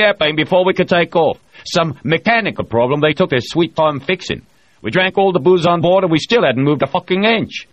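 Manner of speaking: a loud boastful spacer holding court; O'Hara voiced. A narrator's reading. O'Hara brags to the crew, loud and bluff.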